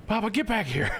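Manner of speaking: high-pitched playful call; mock calling back. Streamer calling back the chatter who pranked, mock scolding.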